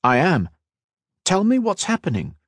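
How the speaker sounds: high, urgent demand. A narrator's reading. Kat pressing Kira for news, demanding. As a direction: press hard for the facts. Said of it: "Kat demanded".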